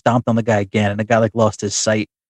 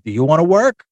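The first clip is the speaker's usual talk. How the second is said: higher-pitched retort, rising into a question; mild mock yell back; 5 semitones higher than usual. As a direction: imitate yelling back, only half raised. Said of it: "then Hacksaw yells back".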